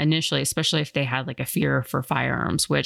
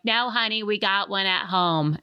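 put-on Gertrude New York accent; 6 semitones higher than usual.

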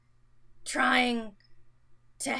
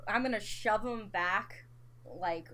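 ordinary talk

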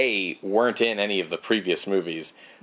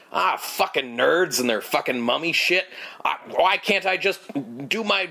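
mock irritated rant, higher, rushing near the end; angry grumbling boss; 5 semitones higher than usual. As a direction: play the annoyed contractor chasing off nerds. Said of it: "sort of like an angrier".

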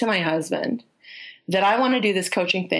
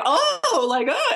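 joyful gushing; 11 semitones higher, much slower than usual. Retold or imitated. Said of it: "he turned all kind of joyful".

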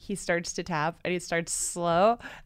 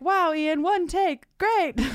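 sarcastic praise; 11 semitones higher, slower than usual. Pretend. Teasing Ian McKellen from the audience, high mock-impressed praise. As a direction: gush with fake awe. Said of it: "you could be like".

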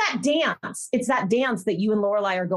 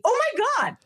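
high, quick, astonished reaction; shocked exclaiming; 12 semitones higher, faster than usual. Retold or imitated. Viewer talking to the TV about a date, high and shocked. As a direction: exclaim high in amazed disbelief. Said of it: "I'm talking to the T V".

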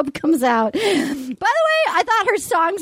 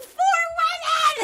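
high, stretched mock outrage; mock alarm; 13 semitones higher, 4 dB quieter than usual. Retelling the women's entrance, hammed-up alarm. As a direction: exclaim it like a scandal.

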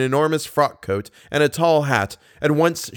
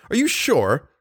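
higher, mildly dismayed question; pleading for it not to be true; a touch of a moan, pitched up; 5 semitones higher than usual. A narrator's reading. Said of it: "moaned Raoul".

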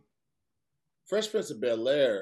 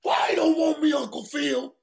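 high, loud plea to a father figure; pleading shout; 12 semitones higher, 4 dB louder than usual. Retold or imitated. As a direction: cry out the line as a hurt kid. Acting out the Uncle Phil scene.

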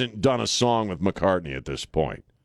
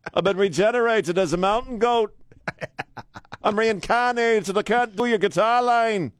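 a clumsy Scouse voice, mostly higher; bad Liverpudlian accent; 10 semitones higher than usual. Radio host mocking Beatles, bad Liverpudlian accent. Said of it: "the worst Liverpudlian accent I've ever made".

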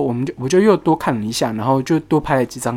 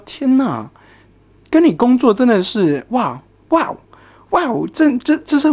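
inner disbelief; 7 semitones higher, slower than usual. Retold or imitated. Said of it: "我会想说".

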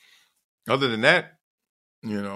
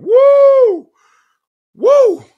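high, loud howl of grief, much louder; howling roar; 12 dB louder than usual. Retold or imitated. Breaking down on grandma's porch, huge howling roar. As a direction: let out a huge wailing woo. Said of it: "the roar".

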